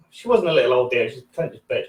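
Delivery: ordinary talk, nothing marked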